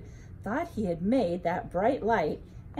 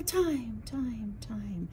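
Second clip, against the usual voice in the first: fading echo; 5 dB quieter, much slower than usual. A narrator's reading. Cave echoes mother's words.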